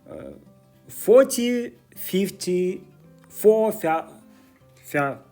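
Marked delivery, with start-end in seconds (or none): none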